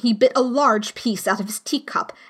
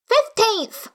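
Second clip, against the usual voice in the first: high March Hare voice; 12 semitones higher than usual. A narrator's reading. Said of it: "said the March Hare".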